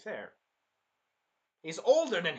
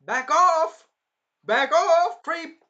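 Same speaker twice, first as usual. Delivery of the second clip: high, loud protective shout; hammed-up yell at a creep; mock yell; 11 semitones higher, 12 dB louder, much slower than usual. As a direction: shove him off with a shrill yell. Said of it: "And I'm like".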